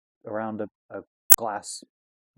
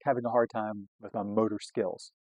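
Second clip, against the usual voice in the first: usual speaking voice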